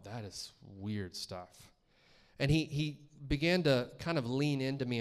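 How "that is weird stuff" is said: low, hushed, very slow aside; uneasy private thought. Retold or imitated. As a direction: draw it out low, as if thinking it. Retold uneasy inner thought.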